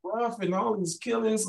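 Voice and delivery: high, exaggerated questioning voice of a nosy stranger; retelling how new people in Texas quiz him about Chicago, in a high mock-curious voice; mimicking curious locals. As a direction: pitch it way up like a wide-eyed outsider asking. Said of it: "And then I get that".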